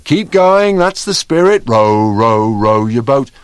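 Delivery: high and loud, rallying the others; rally the troops with a loud singalong; loud cheery encouragement. Acted. Cheering on the singing.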